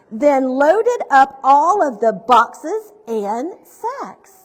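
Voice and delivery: projected, rhyming picture-book narration; story reading to children. A narrator's reading. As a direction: read the rhymes out with big, deliberate emphasis.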